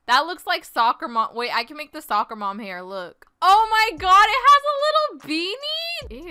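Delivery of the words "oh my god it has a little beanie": high-pitched exclamation, rising higher; excited squeal. Real, not acted. Player spots a little beanie in the game, high excited squeal. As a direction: squeal it in amazement.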